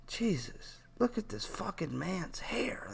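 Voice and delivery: hushed, appalled whisper. Real, not acted. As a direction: whisper it like you can't believe your eyes. Staring at a balding man's hair.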